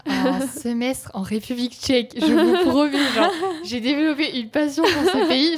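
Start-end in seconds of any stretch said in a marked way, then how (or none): 2.2-5.6 excited gush. Real, not acted. Gushing about dream country.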